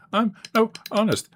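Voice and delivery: put-on high, squeaky little kid denying it.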